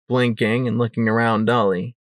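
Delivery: usual speaking voice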